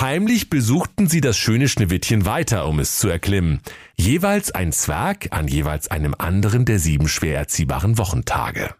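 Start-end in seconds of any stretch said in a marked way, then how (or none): none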